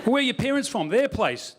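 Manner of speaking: high, pestering Aussie questioner; nosy Australian. Retold or imitated. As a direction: imitate an insistent Australian.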